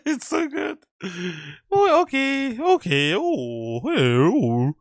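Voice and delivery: laughing high through the words; giggling out a delighted compliment. Real, not acted. Streamer laughing at the accent clip, delighted.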